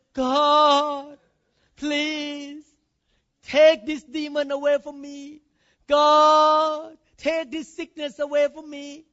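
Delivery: loud, high pleading to God; begging. Retold or imitated. Christians begging God to take the demon, loud and pleading. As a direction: plead like a desperate beggar. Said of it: "They do begging. They are like a beggar".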